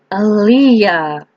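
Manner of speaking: drawn-out groan of the name; exasperated groan. A narrator's reading. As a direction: groan it in protest, stretching it out. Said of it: "Lyle groans".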